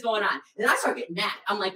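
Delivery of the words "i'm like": normal talking voice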